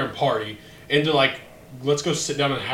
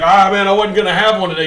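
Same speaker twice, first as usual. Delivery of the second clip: customer's reply; 5 semitones higher, 8 dB louder than usual. Retold or imitated. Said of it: "he's always like".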